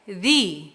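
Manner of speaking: "the" with a long ee vowel; "the" as thee. Shown as a demonstration. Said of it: "Percebe o som mais aberto".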